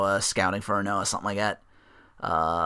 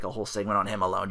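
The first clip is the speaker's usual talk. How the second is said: usual speaking voice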